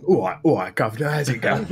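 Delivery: loud, high cockney-style British greeting; British accent. Acted. Brit greeting a stunned Canadian, hammed-up British accent. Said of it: "short wired this guy with my British accent".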